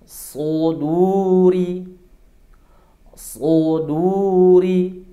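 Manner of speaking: "Suduri" very slow, with a heavy emphatic s; thick so. Shown as a demonstration. Teacher models "Suduri" very slowly for students. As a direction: draw out "Suduri", tongue root high. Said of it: "Bacanya gimana?".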